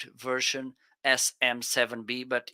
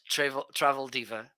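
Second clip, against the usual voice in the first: ordinary talk, nothing marked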